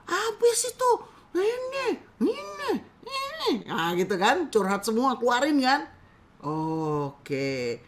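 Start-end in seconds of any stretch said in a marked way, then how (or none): 0.1-3.5 high, fumbling stammer; flustered client. Acted. Client trying to explain her anger, high flustered stammer. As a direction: sputter like someone too upset to find words.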